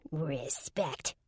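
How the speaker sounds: sneer under breath. A narrator's reading. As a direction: scoff at the word under your breath. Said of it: "the fairy sneered under its breath".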